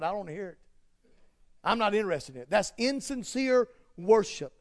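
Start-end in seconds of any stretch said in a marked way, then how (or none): 0.0-2.5 God turning away; flat refusal. Acted. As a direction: lower and softer, unmoved. God refusing insincere worship.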